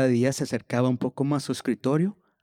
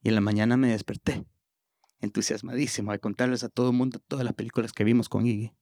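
ordinary talk, nothing marked

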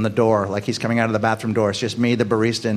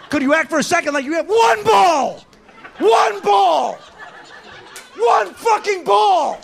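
ranting shout; slower than usual. Acted. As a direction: yell it in disbelief, building to a scream. Comic rant about the order.

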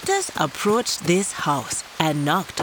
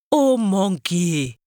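slow, drawn-out plea; a hint of a trapped leopard begging; leopard pleading; slower than usual. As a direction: call to the monkey slowly.

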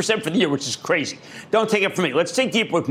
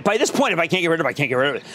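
ordinary talk, nothing marked

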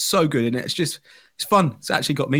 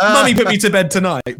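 imitating son; 4 semitones higher, 5 dB louder than usual. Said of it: "he's gone back to".

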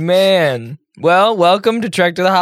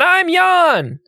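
much higher, slow put-on voice; fake Jan voice; 11 semitones higher than usual. Cohost fakes absent Jan's voice for a joke, high put-on voice. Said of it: "Jan, you don't sound like that".

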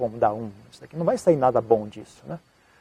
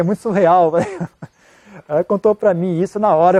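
usual speaking voice

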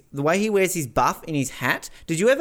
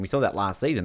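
ordinary delivery

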